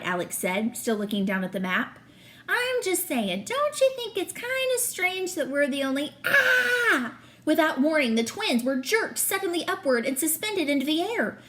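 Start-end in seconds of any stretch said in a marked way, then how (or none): 2.5-7.2 put-on boy's question cut off by a sudden high yelp; startled cry. Boy yanked into a trap mid-question, startled cry. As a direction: breaks off into a shocked cry mid-sentence.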